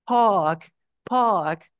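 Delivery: "park" con la A bien alargada y sin R; A más larga. Shown as a demonstration. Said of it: "pero esa A más larga". ("park" británico, A sostenida, R muda)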